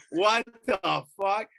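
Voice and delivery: slow, loud, high-pitched indignation; outraged disbelief. Retold or imitated. Owner seeing cops battering his lab, loudly incredulous. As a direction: stretch it out in mock outrage.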